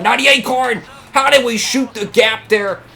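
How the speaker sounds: high, loud astonishment; can't believe they made it through; amazed disbelief. Real, not acted. Racer slipping through the wreck, high amazed voice. Shooting the gap in crash.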